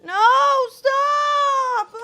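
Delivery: high, loud cry of alarm; real panicked yell; panicked scream. Real, not acted. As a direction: shriek it as everything goes wrong. Panicked cooking disaster, screaming stop.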